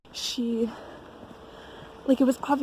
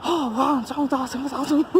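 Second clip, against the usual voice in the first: lightly mimicked French chatter, higher pitched; imitated French chatter; 4 semitones higher, faster than usual. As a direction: rattle it off like mock French. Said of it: "they just start speaking, like, French at me".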